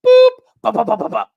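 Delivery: high, loud burst of mouth gunshots, then dying down; mock gunfire noises. Imagining himself fighting when the war starts, loud mock gunfire. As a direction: spit out imitated rifle fire like a kid playing war.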